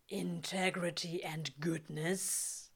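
slow whispered recital; whispering. A narrator's reading. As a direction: breathe the words back slowly. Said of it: "Crowley recites".

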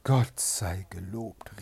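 relieved exclamation. A narrator's reading. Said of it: "rief sie".

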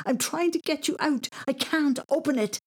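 put-on high, frantic child's protest; very high, urgent boy voice; confused screaming. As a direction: cry out in flustered panic. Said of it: "he screamed, very confused".

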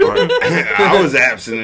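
laughing through the words. Real, not acted. Friend laughs at his own word slip, giggling. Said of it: "laughing at own slip".

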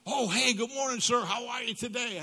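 high, bright eager hello; eager young clerk greeting a regular, high and keen; enthusiastic greeting. Retold or imitated. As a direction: greet him with a burst of enthusiasm. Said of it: "greets me a little bit more enthusiastically".

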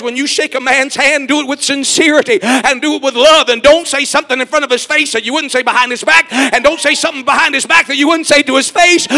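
loud, rising preacher's cry, building to a shout; full-force preaching. Acted. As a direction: preach it out to the whole church, climbing.

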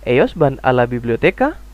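"Ellos van a la biblioteca" as a question, rising at the end; question intonation. Shown as a demonstration. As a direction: lift the last syllable of "biblioteca" as if asking. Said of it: "seperti kamu ketika ingin bertanya".

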